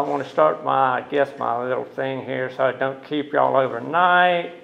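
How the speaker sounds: ordinary talk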